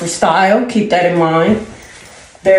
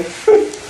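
high breathy giggle. Real, not acted. Laughs at herself.

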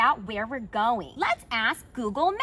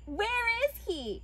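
higher, lightly exaggerated question; kids' show questioning; 6 semitones higher than usual. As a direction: ask the viewers like a cartoon host. Acting kids' show host line.

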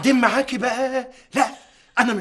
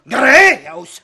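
loud, higher, scolding; sharp rebuke; 5 semitones higher, 8 dB louder than usual. Acted. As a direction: snap at her to stop. Father snaps at wife.

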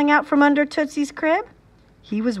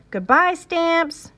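calling out; 6 semitones higher, slower than usual. A narrator's reading. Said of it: "Fudge called from the doorway".

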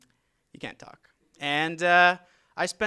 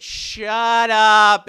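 loud, high, disbelieving yell; stunned shout; 8 semitones higher, 17 dB louder than usual. Retold or imitated. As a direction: blurt it out in shock, full volume. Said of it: "And I said".

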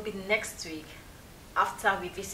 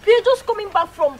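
frantic explaining; 10 semitones higher, 11 dB louder than usual. Acted. Wife explains to husband.

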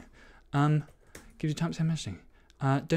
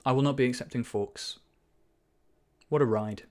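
mock eulogy; 3 semitones higher, 5 dB louder than usual. Continues mock funeral.

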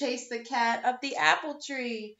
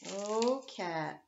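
ordinary delivery, nothing marked

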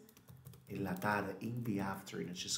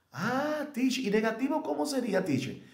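much higher, eager student asking; student question; 13 semitones higher, 4 dB louder than usual. Retold or imitated. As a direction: jump up high like an eager student. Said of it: "student asks the teacher". (estudiante preguntando a la maestra, voz aguda)

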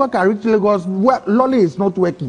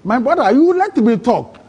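insistent; 7 semitones higher, 3 dB louder than usual. Real, not acted. Insisting the listener let him talk.

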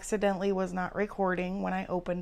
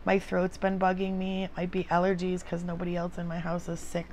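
ordinary delivery

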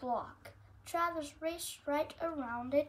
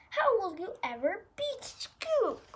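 exasperated; 5 semitones higher, 6 dB louder than usual. Acted. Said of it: "with a groan".

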